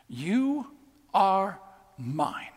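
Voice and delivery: very slow, soft and intimate; Jesus gently telling Saul he belongs; tender claim. Acted. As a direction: linger on each word as a promise.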